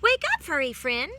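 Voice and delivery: loud, bright chirping call; chirpy bird. A narrator's reading. Bird chirping at the sleeping cat, loud and bright. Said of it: "the bird chirped".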